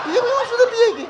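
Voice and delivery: high comic squeal of confusion; mock puzzled squeak. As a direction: squeak it out like a baffled bystander.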